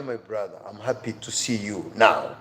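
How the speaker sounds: usual speaking voice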